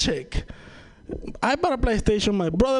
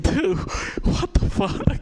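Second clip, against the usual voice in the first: baffled; 3 semitones higher, much slower than usual. Retold or imitated. First guy baffled on phone.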